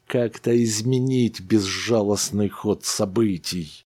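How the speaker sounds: ordinary delivery, nothing marked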